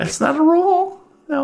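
high chirpy desk clerk; Tracy voice. Acted. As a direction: pitch way up for the clerk.

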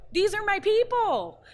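high, loud cry of delighted belonging; excited exclamation. Retold or imitated. Fan first hearing about Chewbacca's, exclaiming excitedly. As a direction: burst out, pitch soaring, thrilled. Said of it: "I just got so excited".

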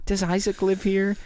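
creepy fan voice. Retold or imitated. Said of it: "be like".

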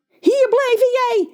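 high, piercing shriek. A narrator's reading. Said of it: "gilt John".

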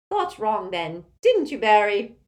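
loud, domineering Auntie Muriel character voice; imperious tipsy old aunt. A narrator's reading. Aunt greeting Harry at the table, imperious and loud. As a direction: project like a tactless old relative.

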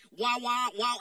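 Charlie Brown teacher wah wah; muffled wah wah talk. Retold or imitated. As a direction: mimic the muffled trombone adult voice. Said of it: "sound like the teacher from Charlie Brown".

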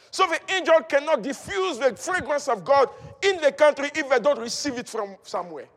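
normal talking voice

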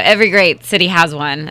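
ordinary talk, nothing marked

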